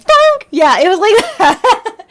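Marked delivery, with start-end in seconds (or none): 0.0-0.5 high, loud mock alert sting; Metal Gear alert sound. Imitating the Metal Gear caught alert, loud and high. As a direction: blurt the game's alert noise. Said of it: "Metal Gear Solid and you get caught".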